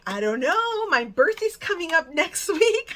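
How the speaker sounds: laughing through a playful hint about her birthday; giggly hint. Retold or imitated. Teasing clerk about her birthday.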